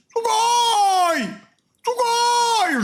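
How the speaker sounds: a streamer hyped on a new word, shouting; excited shout. Acted. Streamer shouting a new Japanese word, excited. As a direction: yell Sugoi at the top of your voice, high. Repeated yelled Sugoi.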